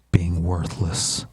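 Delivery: low, very slow and flat-out cold; cold, dispassionate. Retold or imitated. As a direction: deliver the verdict with zero feeling.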